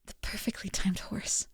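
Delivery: whispered deadpan aside. Real, not acted. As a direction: breathe it out under your breath. Muttered aside after interruption.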